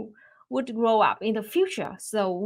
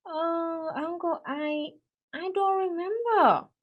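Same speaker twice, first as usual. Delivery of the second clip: put-on little girl voice; 7 semitones higher, slower than usual. Little girl answers shyly.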